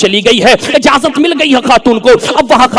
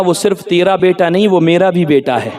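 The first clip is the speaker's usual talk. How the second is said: low, soft quoted words; hushed pronouncement; 7 semitones lower, 5 dB quieter than usual. Acted. Prophet telling Abu Saleh about his son, hushed. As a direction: say it low and quietly, as in a dream.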